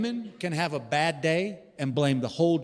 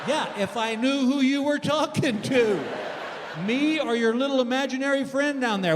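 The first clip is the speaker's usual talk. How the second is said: exasperated shout; 8 semitones higher than usual. Acted. Husband snaps back at wife who was talking to herself, exasperated shout. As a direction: throw it back at her, fed up.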